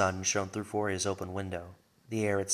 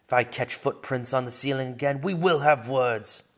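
stern fatherly yell from the kitchen; loud stern dad yelling up the stairs; 4 semitones higher, 8 dB louder than usual. A narrator's reading. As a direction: call her out loud and stern, half teasing. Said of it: "Dad yelled from the kitchen".

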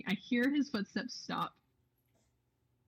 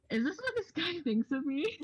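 incredulous; 9 semitones higher than usual. Retold or imitated. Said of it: "And I'm like".

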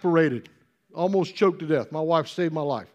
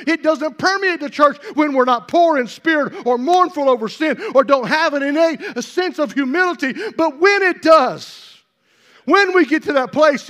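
impassioned preaching; 4 semitones higher, 4 dB louder than usual. Real, not acted. Preacher urging church to come eagerly, impassioned preaching. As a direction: preach it forcefully, punching key words.

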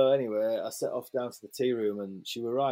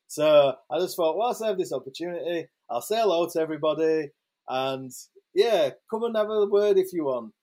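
loud, higher call to a whole crowd; public announcement; 4 semitones higher, 4 dB louder than usual. Retold or imitated. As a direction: address the room to get everyone's attention. Addressing crowd at pub tables.

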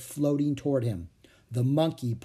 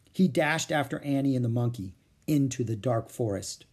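usual speaking voice

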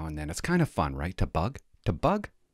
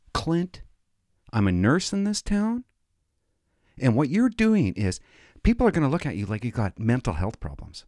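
higher, mock-scolding nurse wife; 5 semitones higher than usual. Wife scolding husband in the car, mock exasperation. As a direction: lay into him, a touch exasperated. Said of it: "she unloaded on me".

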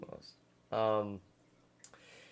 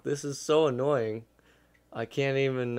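ordinary talk, nothing marked

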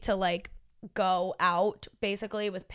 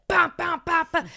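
loud, high door-pounding bams; imitated loud banging; 6 semitones higher, 4 dB louder than usual. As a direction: punch each bam like a fist on the door. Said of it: "it was so loud".

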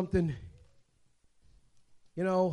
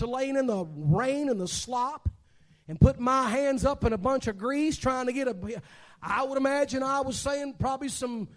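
raised, louder preaching story voice; lively sermon storytelling; 9 semitones higher, 7 dB louder than usual. Acted. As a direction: tell the car story to the congregation with full force. Preacher telling a story with force.

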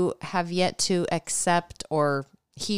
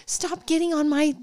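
whiny protest; 10 semitones higher, 5 dB louder than usual. Retold or imitated. Friend voiced protesting in a story.

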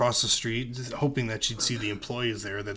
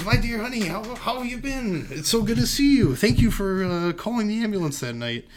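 high-pitched, effusive thanks; hammed-up joyful fussing; gushing greeting; 10 semitones higher, 5 dB louder than usual. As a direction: lay on the affection, high and eager. Acting Cleary's gushing thanks.

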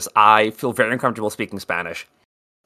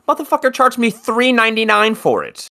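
outraged; 10 semitones higher than usual. Real, not acted. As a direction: rant it, indignant. Outraged about overcharge.

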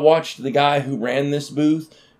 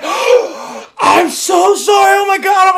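high, loud, hammed-up panicked apology; mock panicked apology; 8 dB louder, slower than usual. Imitating the ex-wife apologizing for the dart, mock panic. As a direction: play her frantic and sorry, way up high and loud.

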